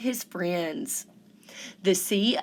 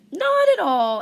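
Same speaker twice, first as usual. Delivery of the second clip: much higher, louder chorus of pebbles; high chipper creature voice; pebbles' voice; 10 semitones higher, 6 dB louder than usual. A narrator's reading. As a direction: answer brightly as pebbles. Pebbles answer cheerfully.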